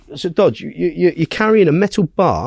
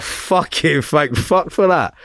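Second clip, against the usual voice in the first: relieved sigh; 3 semitones higher, slower than usual. Retold or imitated. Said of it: "I was like".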